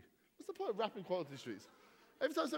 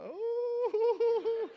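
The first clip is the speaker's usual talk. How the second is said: high startled Oh; comic gasp of surprise on Oh; mock shocked oh; 13 semitones higher, 8 dB louder than usual. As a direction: act taken aback by the vicar-kiss line. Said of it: "I'm like".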